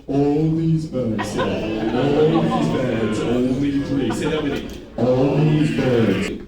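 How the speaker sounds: stretched right out, very slow; slow motion. Shown as a demonstration. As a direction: drag every word like a slow-motion replay. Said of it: "Now do it in slow motion".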